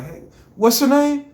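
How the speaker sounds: high, loud demand; loud call. Retold or imitated. Pressing the boy for his name, loud. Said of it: "I get closer like".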